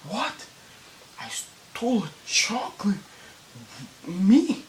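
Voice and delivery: high, disbelieving feigned innocence; mock innocence. To the two guys, hammed-up mock innocence. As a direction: act shocked at the accusation. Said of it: "acting like I didn't know anything".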